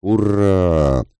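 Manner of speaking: high, drawn-out cheer; battle cry hurrah. Acted. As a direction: yell it as you charge. Soldiers shout during the charge. (протяжное «ура» в атаке)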